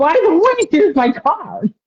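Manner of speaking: joking about a talk show, laughing; laughing joke. Pretend. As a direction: hand over the card through laughter. Joking self-promotion while laughing.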